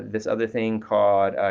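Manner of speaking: ordinary talk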